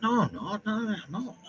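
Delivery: high-pitched mock protest; reluctant, hammed-up "no, no" voice; whining denial. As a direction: imitate her refusing, voice way up high. Retells Sarah's reluctant denial.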